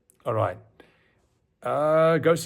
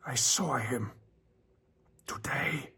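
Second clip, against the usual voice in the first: frightened whisper; 5 dB quieter, slower than usual. Acted. Narrator answers in fear.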